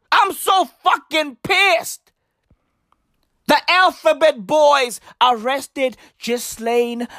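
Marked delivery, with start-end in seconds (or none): 0.1-2.1 high shouted fury; raised furious shout; angry shout. Acted. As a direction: yell the anger out. Furious about the arrest news.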